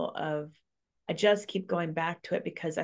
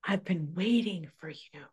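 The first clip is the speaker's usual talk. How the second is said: soft, breathy whisper to a newborn; tender whisper; 7 dB quieter than usual. Retold or imitated. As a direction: whisper it lovingly, like a prayer answered. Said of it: "she probably spoke to him and said".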